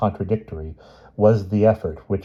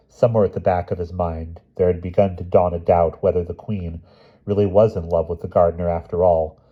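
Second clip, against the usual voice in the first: usual speaking voice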